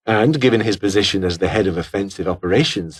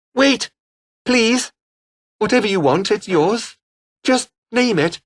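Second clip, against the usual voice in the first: much higher, frantic begging for mercy; desperate pleading; 11 semitones higher than usual. Acted. Cornered man pleading with his captor, frantic and high. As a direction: beg for your life, voice climbing high.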